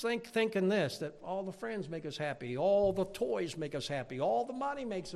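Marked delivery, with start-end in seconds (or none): none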